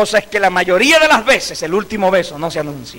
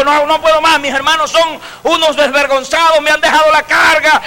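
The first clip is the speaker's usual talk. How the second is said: tearful mother; 7 semitones higher, 3 dB louder than usual. Retold or imitated. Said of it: "Y empezó a llorar".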